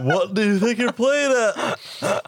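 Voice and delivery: loud, high, laughing stern guardian; Sirius voice. Acted. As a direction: scold him like an angry godfather. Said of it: "Can we get your Sirius to read this letter?".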